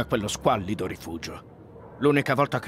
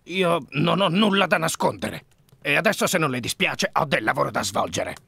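louder, higher, defensive protest; indignant denial; 6 semitones higher, 6 dB louder than usual. Acted. Suspect dismissing Holmes's questions about the letters, indignant. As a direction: brush off the accuser with rising irritation.

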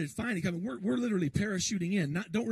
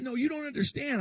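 pitched up, pressing the point; insistent retold protest; 7 semitones higher than usual. To the U-Haul manager, insisting he can't take free rent, pitched up. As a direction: argue back, higher and urgent.

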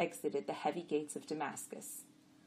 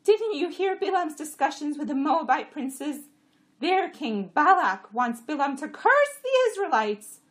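complaining jenny; 11 semitones higher, 12 dB louder than usual. A narrator's reading. Jenny grumbling about the road, high and loud.

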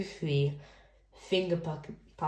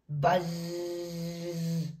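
long sustained "zzz"; drawn-out bee drone; bee buzz; 5 dB quieter than usual. Acted. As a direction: buzz like bees, holding the z.

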